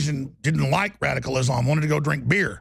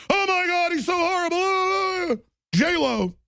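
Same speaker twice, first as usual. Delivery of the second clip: high, slow mock-shocked falsetto; hammed-up horrified fan; mock outrage; 13 semitones higher, slower than usual. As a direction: gasp it like a pearl-clutching celebrity. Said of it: "mocking horrified liberal".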